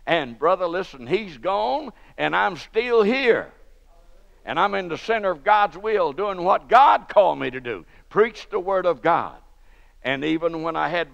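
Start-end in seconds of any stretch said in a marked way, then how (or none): 0.1-9.4 loud, rising preacher's call; preaching at full force. Acted. Preacher to his church, loud and rising. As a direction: drive it home to the congregation.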